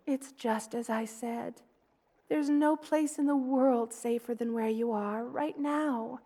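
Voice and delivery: normal talking voice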